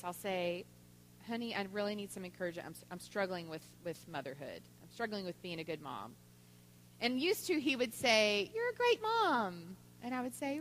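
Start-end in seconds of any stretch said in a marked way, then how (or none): 1.3-6.1 soft, falling plea for encouragement; subdued confession. Retold or imitated. Wife asks husband for encouragement, soft and subdued. 8.5-9.7 mock reassurance. Husband's routine reassurance, retold.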